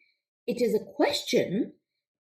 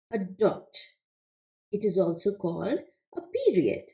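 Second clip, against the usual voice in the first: ordinary delivery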